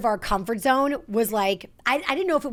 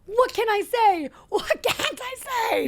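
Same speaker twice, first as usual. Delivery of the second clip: high, laughing whine; mock tantrum; 12 semitones higher than usual. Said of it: "I was like".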